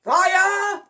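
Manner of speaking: high, loud shouted command; ordering. A narrator's reading. Admiral ordering the next shot, shouted. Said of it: "ordered the Admiral".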